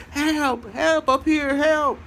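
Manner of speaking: very high falsetto cry for help; mock screaming. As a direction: imitate a woman calling for help in a squeal. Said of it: "She kept screaming".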